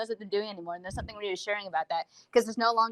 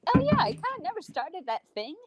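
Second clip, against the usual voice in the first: mock realization; 9 semitones higher than usual. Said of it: "I'm like".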